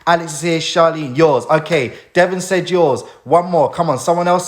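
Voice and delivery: ordinary talk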